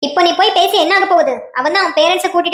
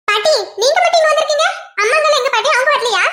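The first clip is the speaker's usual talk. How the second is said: put-on child voice; 7 semitones higher than usual. Grandchild asking grandmother.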